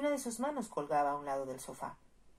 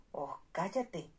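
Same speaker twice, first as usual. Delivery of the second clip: a touch of irritation in the lightly voiced marquis; annoyed; much slower than usual. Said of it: "dijo el marqués, enfadado".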